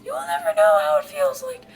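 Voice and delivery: very high, hammed-up falsetto; squeaky put-on anguish; overwrought servant voice. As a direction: wail it like a devoted attendant. Reads Phil's lines in voice.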